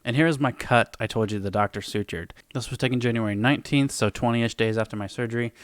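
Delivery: ordinary talk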